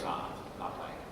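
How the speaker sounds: low, soft, offhand no; quietly opt out, barely voiced; retold kid's refusal. Five-year-old to his dad before game two, soft refusal. Small son declining again.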